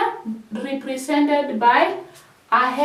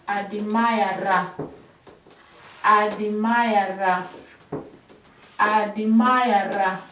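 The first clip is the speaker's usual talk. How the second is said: slow, drawn-out admirer, each syllable clear; slow teacher's model of admirer; slow drill; 4 semitones lower, slower than usual. Shown as a demonstration. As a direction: stretch admirer out slowly for pupils. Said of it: "That is how we drill admirer".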